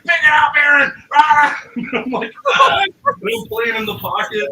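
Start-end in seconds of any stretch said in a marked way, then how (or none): 0.0-0.9 loud, high yell of a furious drummer; imitated screaming. Storyteller imitating the drummer yelling at him on stage, screaming. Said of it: "he's screaming at me". 1.1-1.6 high, loud yell; imitated scream. Storyteller imitating his drummer on stage, loud mock scream. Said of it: "he's screaming at me". 2.5-2.8 very high, loud yelp; mock panicked scream. Storyteller acting his reaction to the screaming drummer, mock panicked scream.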